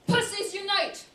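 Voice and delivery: high, loud battle cry; rallying cry. Acted. Performer rallying the women in her audience, shouted high and loud.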